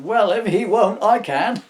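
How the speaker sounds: high loud cheeky reindeer; Rudolph voice. Acted. Rudolph cheekily offers to Santa, high and loud. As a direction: jump in brightly and loud.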